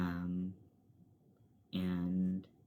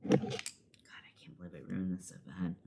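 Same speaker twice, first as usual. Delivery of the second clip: breathy whisper to herself; whispered aside. Real, not acted. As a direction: whisper it to yourself, annoyed. Muttered aside about ruined makeup.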